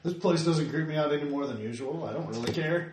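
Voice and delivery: ordinary delivery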